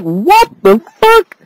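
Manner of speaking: slow, high, stunned what the fuck; mock disbelief at a silly ending; baffled disbelief. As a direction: drag it out, imitating a confused viewer. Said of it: "And you're like".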